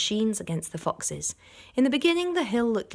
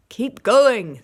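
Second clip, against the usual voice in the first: much louder shout; 4 semitones higher, 12 dB louder than usual. A narrator's reading. Boggis shouting to Bunce and Bean, loud. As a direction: bellow it at the other farmers. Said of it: "the fat Boggis shouted".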